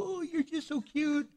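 mock doting coo; cheek-pinching coo. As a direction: coo at a grown son like a toddler. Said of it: "I get their cheeks and go".

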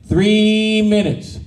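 "three minutes" drawn out slowly; slow and deliberate. Shown as a demonstration. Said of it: "do your three minutes".

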